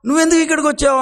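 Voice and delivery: loud, bold counter question; naughty Krishna acting innocent; cheeky child. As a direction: turn the tables on her. Krishna asks back cheekily.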